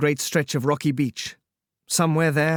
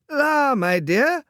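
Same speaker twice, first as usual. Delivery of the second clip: much higher, very slow foppish drawl; good-natured drawl; 12 semitones higher, 3 dB louder, much slower than usual. Acted. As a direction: stretch it out lazily and kindly. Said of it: "he rejoined good-naturedly".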